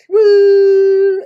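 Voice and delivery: loud high whoop; big celebratory woo; excited cheer. Real, not acted. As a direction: whoop it up to open the show.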